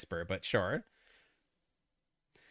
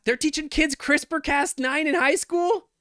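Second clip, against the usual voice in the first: shocked; 10 semitones higher, 4 dB louder than usual. Real, not acted. First shocked question.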